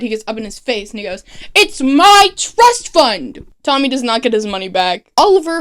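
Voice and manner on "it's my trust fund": higher, louder, slow petulant shout; mock angry yell. Son screaming at his father over money, mock rage. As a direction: stamp each word like a furious spoiled son. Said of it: "Tommy gets so mad".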